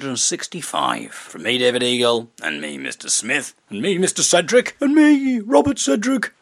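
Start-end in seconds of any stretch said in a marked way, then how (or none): 2.4-3.5 low, soft teacher voice; Mister Smith. Acted. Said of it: "a man of many voices". 3.7-4.8 high-pitched father character; Mister Cedric. Acted. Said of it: "a man of many voices". 4.8-6.3 very high squeaky schoolboy voice; hammed-up little boy sign-off; Robert Cedric. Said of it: "a man of many voices".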